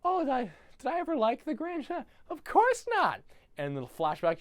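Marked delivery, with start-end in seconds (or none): 0.0-3.2 put-on high, loud squeaky voice of a girl brushing it off; high squeaky girl pretending she never cared; mock girly denial. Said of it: "she's like".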